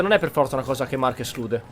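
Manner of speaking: ordinary talk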